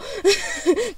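short, breathy burst of real laughter; overwhelmed laugh. Real, not acted. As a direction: laugh helplessly with excitement. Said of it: "estoy implosionando". (risa desbordada de emoción)

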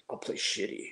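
hushed, breathy, smug rock star; pompous rocker. Retold or imitated. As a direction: sneer it like a pompous eighties rocker. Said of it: "I sounded like, a pompous asshole".

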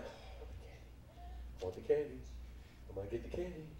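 low, sneaky acted murmur; tempted kid. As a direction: whisper it like a child eyeing forbidden candy.

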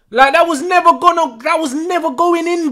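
high, heated protest; exasperated complaint. Real, not acted. Fan telling player it was never going in, exasperated complaint.

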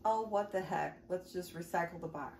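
ordinary talk

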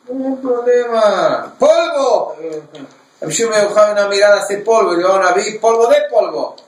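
high, loud, mocking bravado; brash scoffing officer. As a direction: sneer at him like a cocky soldier. Bold captain scoffs at the prophet. (oficial atrevido, burlón y a gritos)

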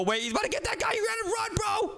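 high-pitched panicked warning; urgent warning. Real, not acted. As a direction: warn them in a high, frantic squeal. Warns player the monster is coming.

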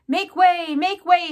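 high, loud herald's shout. A narrator's reading. Furlow pushing through the crowd, shouting. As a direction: shout to clear a path. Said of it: "shouted Furlow".

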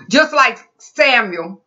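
ordinary talk

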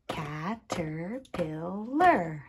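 "Caterpillar" slowly split into four beats; tapped syllables. Shown as a demonstration. Teacher showing sound groups, "Caterpillar" slow, syllable by syllable. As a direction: say "Caterpillar" slowly as ca, ter, pil, lar. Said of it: "tap out the different sound groups".

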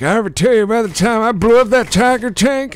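put-on high, fast, loud old-timer bragging; old grandpa voice. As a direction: crank up the wacky grandpa. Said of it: "imitating my grandfather".

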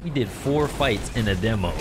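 ordinary delivery

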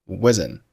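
wasn't with the final t left unexploded; unreleased t. Shown as a demonstration. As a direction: say wasn't as "wuzzn", swallowing the t. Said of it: "t可以不用爆出来".

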